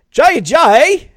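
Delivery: "Jay Ajayi" said high and loud in a British accent; mock British take on "Jay Ajayi". Shown as a demonstration. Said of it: "I do a British accent with Jay Ajayi".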